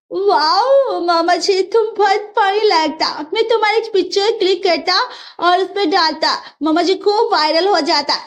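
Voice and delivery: mock her for falling; mocking bride. Bride laughs at the fallen mother-in-law, teasing.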